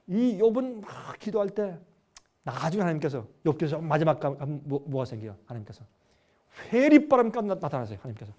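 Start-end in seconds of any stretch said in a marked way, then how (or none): none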